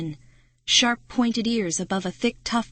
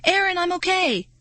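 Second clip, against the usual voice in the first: higher, louder reassuring call; calling back; 11 semitones higher, 4 dB louder than usual. Acted. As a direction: shout back that he's fine. Friend answers from mud.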